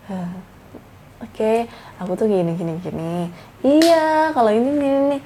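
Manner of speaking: hesitant, halting mumble with fillers; uninteresting presenter. Shown as a demonstration. Speaker showing an audience how not to present online, unfocused and mumbling. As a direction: fumble through it, trailing softly. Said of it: "Not interesting at all".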